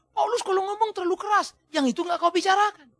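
imitated grumbling objection; mock complaint. Voicing critics' complaint about Paulus.

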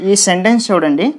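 usual speaking voice

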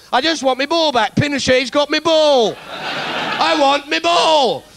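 high, slow whining tantrum; childish whine. Pretend. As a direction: wail like a kid who lost his ball. Childish tantrum over ball.

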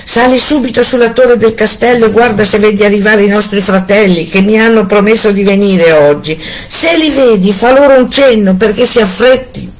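frightened wife sends her sister to watch; urgent wife. A narrator's reading. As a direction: hurry her sister up the tower. Wife urges sister Anna.

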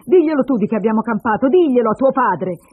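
loud, high, insistent order; shouting. Acted. Mother demands daughter tell her father, shouting. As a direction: hammer the demand at the child. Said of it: "gridò lei alla bambina".

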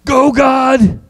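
high, hollered sports chant; big held-out fan yell; practice cheer. Shown as a demonstration. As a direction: shout it for the group to echo. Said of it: "let's practice".

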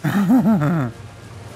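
long rippling laugh. Real, not acted. Gamer fleeing the beavers, laughing. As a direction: laugh it out in a quick run of bursts. Beavers chase after touching the dam.

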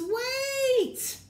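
loud high cry of Wait; shout. Acted. Bear cries out to stop his friends, loud. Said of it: "not what he's supposed to say".

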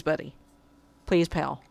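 ordinary talk

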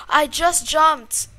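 high, loud, drawn-out cry; horrified realisation. Real, not acted. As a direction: gasp and wail it slowly. Broke her own rule.

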